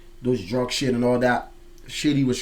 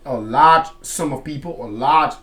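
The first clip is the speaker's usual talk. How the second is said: usual speaking voice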